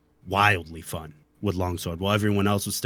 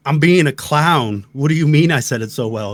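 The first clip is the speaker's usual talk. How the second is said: exasperated retort; 5 semitones higher, 7 dB louder than usual. Retold or imitated. Husband retorts to wife praising his goofy tones, exasperated. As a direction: protest loudly, stretching the words in disbelief.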